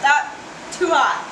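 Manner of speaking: hot food in the mouth; talking through a too-hot bite; burnt mouth. Real, not acted. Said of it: "Burn my mouth".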